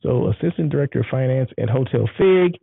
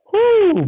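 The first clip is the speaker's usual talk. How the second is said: high, loud whoo of delight; excited whoo; 6 dB louder than usual. Real, not acted. Whooping with delight over the spicy food. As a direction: let out a joyful whoop. Excited about the hot sauce.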